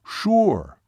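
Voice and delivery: high, falling, certain "sure"; imitated Seminole man. Seminole confirms to doubting waitress, short and emphatic. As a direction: confirm it in one emphatic word. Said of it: "even imitating his voice and gestures".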